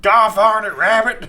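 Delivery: high, loud cartoon cowboy holler; Yosemite Sam. Mocking a friend's western voice, loud high holler. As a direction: holler it like a blustering cartoon gunslinger. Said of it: "Yosemite Sam over here".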